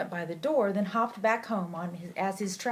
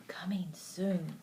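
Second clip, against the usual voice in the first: whispering; 3 semitones lower, 6 dB quieter, slower than usual. A narrator's reading. Hushed aside in bedtime story.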